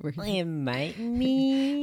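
put-on high, very slow sing-song girl voice; valley girl.

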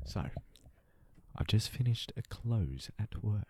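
mock narrator. Storyteller setting up a work tale, slow mock narration. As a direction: open the tale slowly and low, for immersion.

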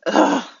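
high, loud-ish "ugh"; disgusted groan. Real, not acted. Disgust at the acted scene.

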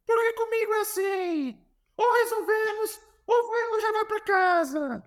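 high, stern mock Portuguese coach, slowing at the end; Jorge Jesus impression. Coach confronting his player in the office, high mock Portuguese voice. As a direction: lay down an ultimatum in a put-on high Portuguese voice. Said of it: "ele grita assim".

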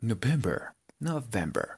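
November repeated softly, posh English-style; posh "gomelo" pronunciation. Shown as a demonstration. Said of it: "lo más gomelo que puedan". (November gomelo y suave)

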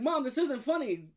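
high, exasperated mock whine; exasperated protest. Texting mom after her laughing emoji, exasperated protest. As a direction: protest it high to an unhelpful mother.

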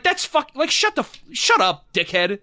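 usual speaking voice

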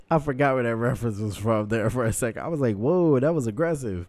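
real startled amusement; amused shock. Real, not acted. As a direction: exclaim it loudly, still reeling from laughter. Taken aback by aggressive quote.

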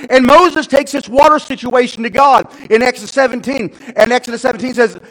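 loud, high narration; full-force preaching. Real, not acted. Preacher introducing God's answer to Moses, full-force. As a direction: drive the story forward at full voice.

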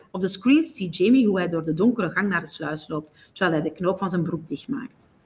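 usual speaking voice

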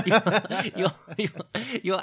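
loud, high laugh breaking the words; can't get the words out for laughing; laughing fit. Real, not acted. Host mocking guest's accent, loud laughing stammer.

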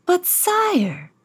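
high, meek protesting duke voice; a nervous courtier objecting; timid Grand Duke. Acted. Grand Duke pleads with the king, high and meek. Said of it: "said the Grand Duke".

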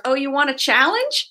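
lightly voiced, teasing challenge; playful retort from on high; God taking up a dare. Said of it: "he's like".

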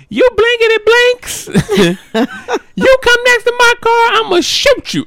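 put-on a tough old lady yelling a warning; fierce threatening grandma. Grandma threatens other car.

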